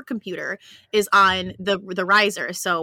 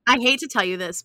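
usual speaking voice

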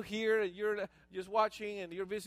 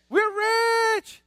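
loud, high celebratory shout; excited good news; 12 semitones higher, 9 dB louder than usual. Shown as a demonstration. Preacher proclaims the good news to the congregation, excited shout. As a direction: announce it high and triumphant.

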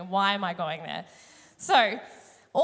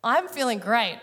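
usual speaking voice

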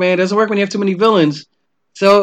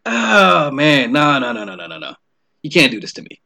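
mock despair; 5 semitones lower than usual. Retold despair at poster.

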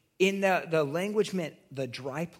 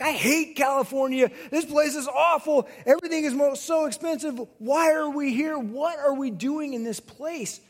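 loud, high-pitched angry rant; reenacted screaming tirade at a wife; retold yelling; 9 semitones higher, 5 dB louder than usual. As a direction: yell it like you've lost it, easing on the last question. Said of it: "I'm, like, yelling at Becca".

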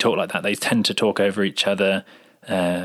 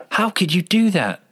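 higher, louder angry outburst; mock angry; 10 semitones higher, 3 dB louder than usual. Shown as a demonstration. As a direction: snap it at the other person in anger. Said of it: "angry with each other and they're saying".

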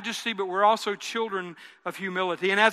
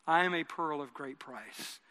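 quiet, sincere repeat; 5 semitones lower, 9 dB quieter than usual. Shown as a demonstration. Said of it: "say it like you mean it".

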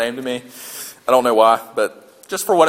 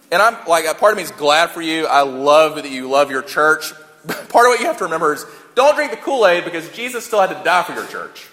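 ordinary talk

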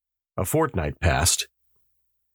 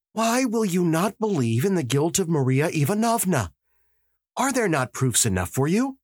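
a hint of an agitated young man; lightly voiced eager young detective; 10 semitones higher than usual. Dukovsky urging the magistrate about the guilty, lightly voiced restless insistence. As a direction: press the point, restless and nervous.

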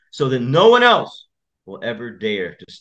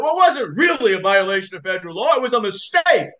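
high, lightly voiced judge making excuses; mock defensive judge; 9 semitones higher than usual. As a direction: squirm out of it like a caught judge. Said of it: "he was shouting at him".

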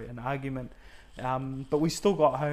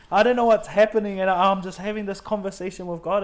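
much louder and higher, carried away; overwhelmed; 6 semitones higher, 8 dB louder than usual. Real, not acted. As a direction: swept up in the memory, louder. Overwhelmed recalling the moment.

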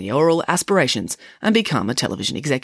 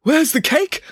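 high, eager demand; impatient actor's cry; 12 semitones higher, 4 dB louder, slower than usual. Acted. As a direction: ask urgently for the promised treat. Actor demands promised cake.

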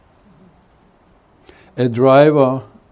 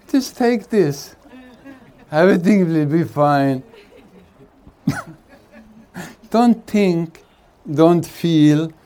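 higher, hammed-up doctor brushing off the patient; mock pill-pushing doctor; 4 semitones higher than usual. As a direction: parody a glib shrink handing out pills. Mocking a psychiatrist's advice.

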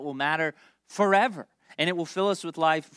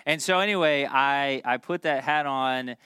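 ordinary talk, nothing marked

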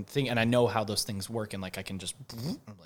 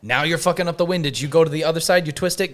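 normal talking voice

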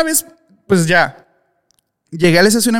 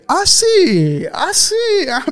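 mocking; 11 semitones higher, much slower than usual. Mocking the guard's stare.

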